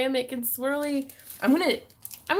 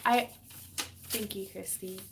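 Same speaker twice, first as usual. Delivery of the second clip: ordinary delivery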